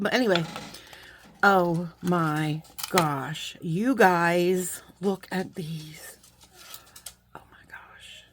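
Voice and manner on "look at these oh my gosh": soft, slow whisper of amazement; whispered wonder at the earrings; awed whisper. Real, not acted. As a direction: hush your voice in disbelief.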